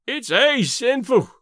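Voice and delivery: slow high moan. A narrator's reading. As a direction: moan it out slowly, lamenting. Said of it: "Davy moaned".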